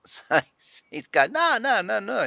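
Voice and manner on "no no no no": mock innocent denial. Dad feigning innocence.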